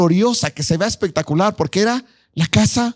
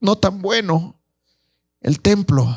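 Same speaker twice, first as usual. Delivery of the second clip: lamenting; 4 semitones higher, much slower than usual. Acted. Old men lamenting the temple.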